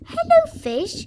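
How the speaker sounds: put-on very high sweet child greeting; Peppa greeting. Peppa greeting a fish in the pool, high cooing. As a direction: coo hello to the fish. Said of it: "says Peppa".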